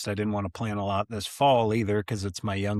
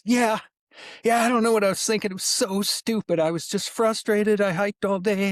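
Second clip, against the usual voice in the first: high, fast and trembling; nervous kid; 11 semitones higher than usual. Retold or imitated. Shaking kid in the truck confessing to the porcupine shot, high and rushed. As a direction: rattle it off like a scared teen. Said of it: "he's, like, vibrating in the seat".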